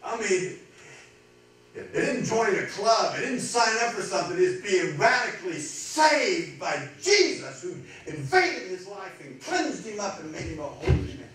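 loud, high, drawn-out proclamation; full-force preaching. Real, not acted. Preacher proclaiming a man saved by Jesus, full-force preaching. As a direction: stretch the key words and ring them out.

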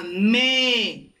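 "me" stretched out for learners; one long, deliberate "me"; sílaba modelo. Shown as a demonstration. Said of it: "sílaba". ("me" con la vocal alargada)

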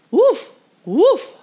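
high, loud yappy woofs; barking dog. A narrator's reading. Little dog barking at the school bus. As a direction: bark it like an eager little dog. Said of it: "barked Titus".

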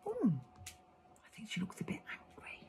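soft slow whisper; hushed storyteller aside. Real, not acted. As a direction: lean in and whisper it. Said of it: "whispered aside to children".

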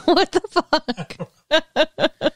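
laughing. Real, not acted. Laughing disbelief at friend's remark.

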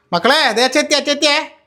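put-on calling the kids, high and loud. Father calling to his kids that he's home, loud and high. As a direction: announce I'm home to the kids.

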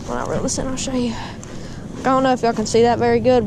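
ordinary talk, nothing marked

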